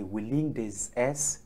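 usual speaking voice

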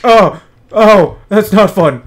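hammed-up pained groaning, slowing down; imitating injured man. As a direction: play the man who tripped, mildly loud. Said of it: "He was like".

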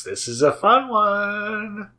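a goofy high slow drawl; odd put-on voice. Shown as a demonstration. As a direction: stretch it out up high, half-joking. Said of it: "I don't know why I'm talking like that".

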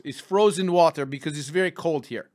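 put-on deadpan joke in a Russian accent. Host explaining his poop joke to his producer, Russian accent. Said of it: "but Russian".

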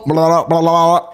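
low mock caveman blah; caveman gibberish. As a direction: babble thank you like a caveman. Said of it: "that's thank you in a caveman language".

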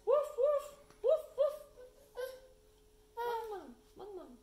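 high, loud puppy woofs; baby puppy. Acted. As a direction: bark like an excited little puppy. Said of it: "the baby puppy says".

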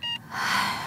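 long breathy unvoiced sigh; weary sigh. Sick mother sighing before she answers her daughter, weary sigh. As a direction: let out a tired sigh before speaking.

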